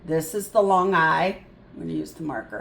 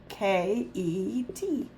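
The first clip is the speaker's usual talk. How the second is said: softer, slow letter-by-letter; slow spelling; 6 dB quieter, much slower than usual. Shown as a demonstration. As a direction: spell K E T slowly. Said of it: "spelling letters slowly".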